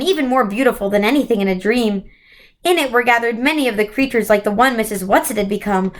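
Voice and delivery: ordinary delivery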